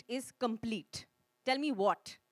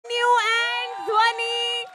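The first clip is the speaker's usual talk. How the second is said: loud, high, drawn-out stage call; calling out performers; 8 semitones higher, 8 dB louder, much slower than usual. Real, not acted. Host summoning singers to the big screen, calling out. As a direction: stretch the names out high and loud.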